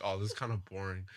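bored. Retold or imitated. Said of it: "It was like".

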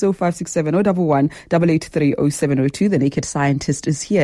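ordinary talk

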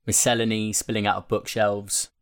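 ordinary talk

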